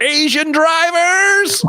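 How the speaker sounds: high, slow shaking-fist cry; mock angry yell at a driver; imitating Tita. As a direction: yell it at the passing car, slowly. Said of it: "put her fist in when she went".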